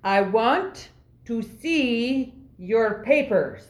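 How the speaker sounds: word by word, as if to a foreigner; slow, emphatic demand. Acted. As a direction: spell out the demand with long pauses. Charades for supposed non-English speaker.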